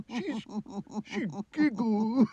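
high, wailing king sobbing oh dear; crying king. Acted. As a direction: wail like a weepy king. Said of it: "went the king".

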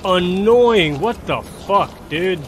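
real angry yelling. Real, not acted. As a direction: blow up at the game, yelling. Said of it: "I'm getting mad".

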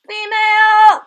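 Female! cried out high and loud; excited shout. Real, not acted. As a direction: yell Female! with delight. Repeats the discovery louder.

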